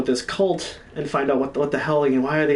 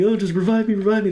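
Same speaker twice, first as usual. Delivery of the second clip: imitating a friend's pleading, higher voice; mock-urgent plea from a downed teammate; Louis; 8 semitones higher than usual. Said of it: "And he's like".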